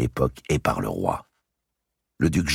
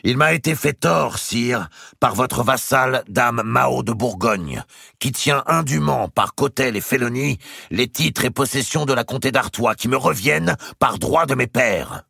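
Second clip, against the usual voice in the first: high, loud accusation; Robert's accusation; 11 semitones higher, 4 dB louder than usual. A narrator's reading. Robert accusing his aunt before the king, high and loud. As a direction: denounce her before all the peers.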